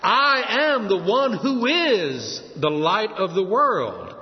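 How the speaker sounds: slow, weighty stress on "I am"; emphatic. Shown as a demonstration. Preacher showing the Greek phrasing to congregation, emphatic. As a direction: draw out "I am the one who is" with emphasis. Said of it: "but it's emphatic".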